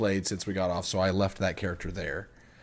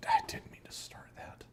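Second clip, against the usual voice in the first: whisper; 7 dB quieter than usual. Real, not acted. Whispered aside after a mistake.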